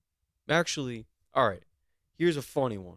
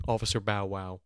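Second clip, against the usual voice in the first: ordinary talk, nothing marked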